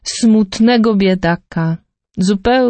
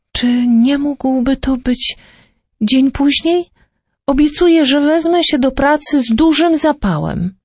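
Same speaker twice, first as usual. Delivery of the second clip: pleading; 6 semitones higher than usual. Acted. Poor father begging for delay.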